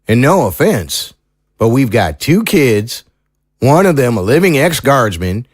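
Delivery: country lawman reasoning it through; folksy small-town sheriff, doubtful. Acted. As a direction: play a sheriff tactfully laying out the problem. Sheriff figure doubts the proof.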